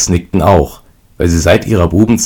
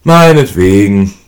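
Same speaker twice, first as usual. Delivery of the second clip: slow, louder word drawn out through a yawn; 3 semitones higher, 6 dB louder, slower than usual. A narrator's reading. Fourth brother agreeing lazily, yawning slowly. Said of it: "gähnte der Vierte".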